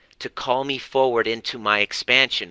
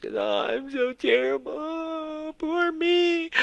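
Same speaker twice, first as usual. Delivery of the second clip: high whiny mock wail of a crying parent; mocking self-pity; slower than usual. Parent mocked for wallowing, in a high whiny wail. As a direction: parody a parent sobbing over their guilt, much higher. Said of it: "people are, like, in tears".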